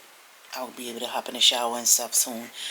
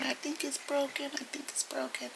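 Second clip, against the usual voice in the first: high, softer mock whimper; imitating a crying man; 13 semitones higher, 7 dB quieter than usual. Neighbor moaning about his broken foot, soft high whimper. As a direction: whimper it like a hurt old man. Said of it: "you just hear him crying".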